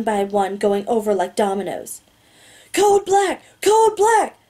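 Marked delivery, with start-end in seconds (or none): 2.7-4.4 high, urgent alarm shout; screamed. A narrator's reading. Guard alerting others by walkie-talkie, screaming. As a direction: scream the emergency code. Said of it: "the large guard screams into his walkie-talkie".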